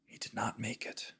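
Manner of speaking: very soft, voiceless whisper; whispered aside. Real, not acted. Game master confides failed saving throw.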